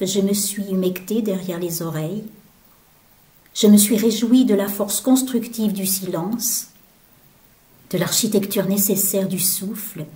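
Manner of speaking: ordinary delivery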